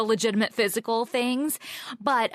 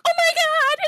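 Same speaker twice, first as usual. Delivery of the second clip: much higher and louder, panicked "oh my God"; frightened friend; 6 dB louder, slower than usual. Retold or imitated. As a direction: shriek it like the doll just moved.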